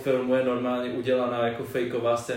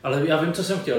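ordinary talk

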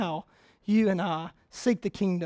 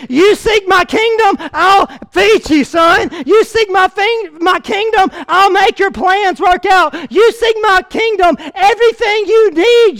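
a hint of Jesus speaking to his son; high, loud, earnest promise as God; voicing Jesus; 10 semitones higher, 11 dB louder than usual. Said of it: "What Jesus says is".

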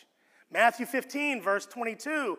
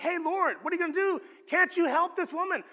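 high, sincere plea on her behalf; pleading disciples; 9 semitones higher, 4 dB louder, faster than usual. Retold or imitated. Disciples urging Jesus to help the woman, high and earnest. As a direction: lift it high, asking him to help her. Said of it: "they're like".

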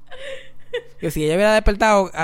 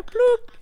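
high pop sound effect. Retold or imitated. Imitates the clock-in punch.

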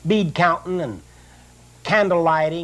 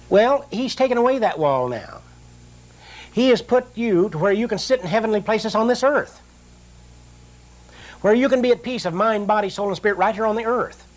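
rising preaching; 4 semitones higher than usual. Acted. Preaching rises in pitch.

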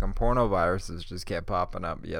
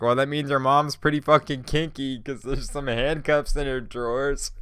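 louder, higher mock teasing; retold teasing; 5 semitones higher, 6 dB louder than usual. To his friend about the handcuffs, loud teasing. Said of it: "And I'm like".

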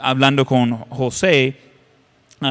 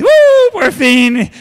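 triumphant glee; 14 semitones higher, 7 dB louder, much slower than usual. Retold or imitated. Preacher imitating husband Joel gloating: a high triumphant whoop. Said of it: "él está todo contento y dice".

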